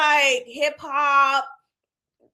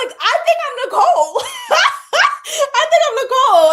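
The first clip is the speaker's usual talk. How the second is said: higher and louder, bursting with laughter; laughing shout of recognition; laughing exclamation; 12 semitones higher, 7 dB louder than usual. Real, not acted. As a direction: cry it out through real laughter. Laughing realization about herself.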